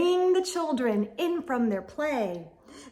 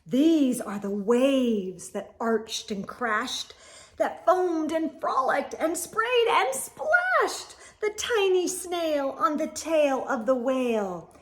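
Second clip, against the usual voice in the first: ordinary delivery, nothing marked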